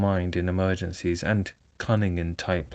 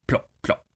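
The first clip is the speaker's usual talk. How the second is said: imitated tear plops. A narrator's reading. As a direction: mimic tears dropping on a table. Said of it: "splashed on the table".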